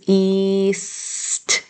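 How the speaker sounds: east stretched, long ee into long s, crisp t; sounded out. Shown as a demonstration. Teacher modelling spelling to pupils, "east" sounded out slowly. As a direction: hold each sound of "east" in turn. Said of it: "So say the sounds".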